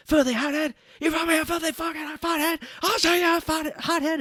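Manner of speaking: high, loud indignant screaming; hammed-up fury; mock enraged Cage. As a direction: explode at the insult.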